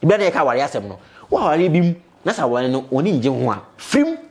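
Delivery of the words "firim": squeaked up high and quick, like something vanishing; sudden exit sound word. Acted. Host's guest marks a marriage ending with a high yelp.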